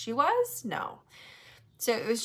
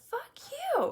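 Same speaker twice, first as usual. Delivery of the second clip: sharp, much higher mock yell; angry retort. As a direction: fire it back high-pitched but not shouted. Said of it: "I was pissed".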